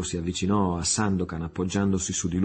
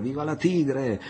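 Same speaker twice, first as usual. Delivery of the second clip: high, sustained hurrah; crews cheering; 6 semitones higher than usual. A narrator's reading. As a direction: cheer on and on like a crew. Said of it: "gli equipaggi gridavano sempre".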